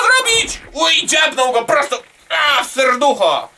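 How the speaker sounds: high, loud yelp of commentary; excited outburst. Real, not acted. Gamer reacting to a sudden stab, excited outburst. Startled as enemy gets stabbed in game.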